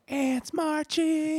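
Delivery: very high, drawn-out squeal; voicing the Dalai Lama. Dalai Lama requests a song, high squeaky voice. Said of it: "Was the Dalai Lama like".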